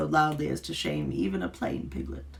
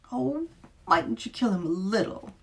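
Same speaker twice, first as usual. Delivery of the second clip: sweetly polite, pitched up; most courteous voice; 9 semitones higher, 3 dB louder than usual. A narrator's reading. Beast politely asking the witch, overly courteous. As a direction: ask it with elaborate courtesy. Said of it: "with my best and most courteous voice".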